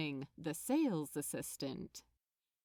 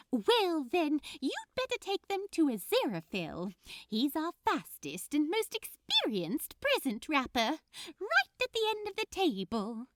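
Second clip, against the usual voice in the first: much higher and louder, eager helper voice; enthusiastic elf; 7 semitones higher, 4 dB louder than usual. Acted. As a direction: gush the offer like a delighted elf. Elf directs him to Aziraphale.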